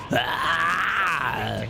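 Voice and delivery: long drawn-out villain power-up cry; power-up yell. Acted. Villain powers up mid-battle, long yell. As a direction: sustain a theatrical charging yell.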